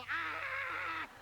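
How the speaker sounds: short hammed-up scream of frustration; mock scream. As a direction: let off a mock yell in the closet. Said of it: "go to the closet and go".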